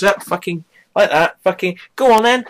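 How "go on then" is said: higher and louder, goading; taunting dare. Retold or imitated. Man dares his flatmate to hit him, loud and goading. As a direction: egg her on, daring her to swing.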